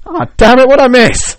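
high, loud hammed-up outburst; mock frustrated yell. The American rower finding out about the war, high loud mock yell. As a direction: yell it like a man who missed the news. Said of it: "He's like".

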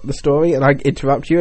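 usual speaking voice

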